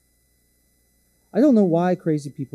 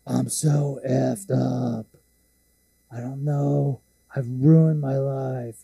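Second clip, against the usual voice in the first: mock sobbing drunk; much slower than usual. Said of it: "He starts sobbing".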